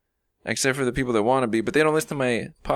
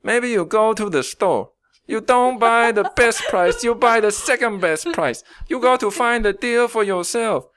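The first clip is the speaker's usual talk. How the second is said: put-on foreign accent pitched much higher, like a salesman pep talk; hammed-up Borat-style accent giving life advice; comic high foreign accent, motivational rant; mock accent, Borat-style; 8 semitones higher than usual. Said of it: "maybe the Borat, possibly".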